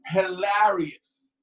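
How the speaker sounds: usual speaking voice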